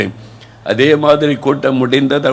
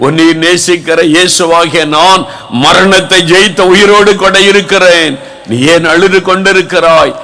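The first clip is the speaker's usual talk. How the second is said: building to a loud, higher, fervent plea; affectionate Jesus voice; 3 semitones higher, 6 dB louder than usual. Acted. Jesus asks the weeping believer, growing loud and fervent. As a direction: call out to the weeping one with rising love. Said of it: "பாசத்தோடு அவர் கேட்கிறார்".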